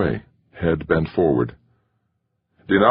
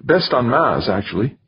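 higher-pitched, lightly joking remark; 8 semitones higher than usual. A narrator's reading. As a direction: toss it off as a light joke. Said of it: "V J joked".

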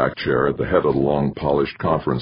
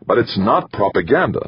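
hurt cry; 6 semitones higher than usual. A narrator's reading. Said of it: "Maxwell cried, sounding genuinely hurt".

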